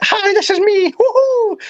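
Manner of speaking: squeaky high and giggly, gushing to the camera; mock her bubbly first-live greeting; high-pitched laughing hello; excited squeal. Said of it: "But you were like".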